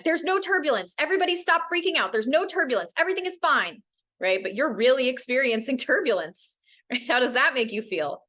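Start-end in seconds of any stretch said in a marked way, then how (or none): 0.0-3.8 much higher, hurried denial; dismissive pilot. Retold or imitated. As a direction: brush off the passengers' fear. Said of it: "the pilot came on and said".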